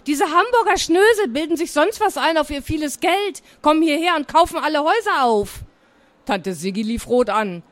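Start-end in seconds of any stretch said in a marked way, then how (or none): 0.1-5.6 higher-pitched, scornful parroting; indignant rant. Acted. Girl quotes her aunt's words about neighbours, indignant. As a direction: rattle off someone's grievance with indignation.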